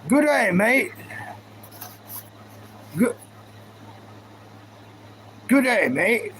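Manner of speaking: light mock Australian accent, cheery; a hint of an Australian greeting, lightly put on. Greeting viewers in a lightly put-on Australian accent. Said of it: "Now, now I'm Australian".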